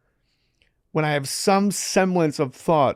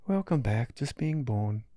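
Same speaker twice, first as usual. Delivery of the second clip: soft Australian welcome; soothing Aussie healer; Australian accent; 6 dB quieter than usual. Retold or imitated. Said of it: "he's Australian".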